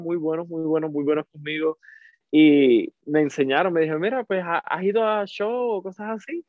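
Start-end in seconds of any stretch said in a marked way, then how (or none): 4.0-6.5 higher-pitched, rising friendly question; lightly voiced stranger. The couple suggesting a show to him, lightly voiced friendly question. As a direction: ask it in a lightly imitated higher voice.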